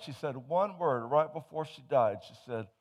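normal talking voice

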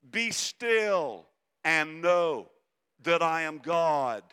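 Be still: proclaim it slow and strong. Acted. Preacher booming the psalm to the congregation, slow and loud. Psalm quoted twice, contrasted.

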